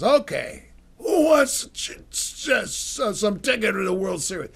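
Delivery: loud, high, straining call to a lobby; hammed-up announcement, stumbling over the words; dad hawking tickets. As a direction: call out to a crowd from up on a chair. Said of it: "he'd get up on a chair in the lobby".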